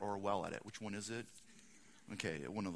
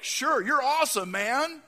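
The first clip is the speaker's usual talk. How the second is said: high, loud gushing praise; gushing fans; 11 semitones higher, 10 dB louder than usual. Retold or imitated. Boys answering the storyteller, high and loud gushing. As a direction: voice the boys cheering you on. Boys' gushing reply voiced.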